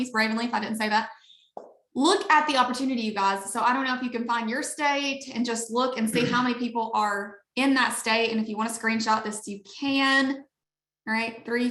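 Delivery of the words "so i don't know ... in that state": ordinary talk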